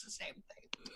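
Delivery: hushed whisper; whispered aside. Acted. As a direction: breathe it out as a secret.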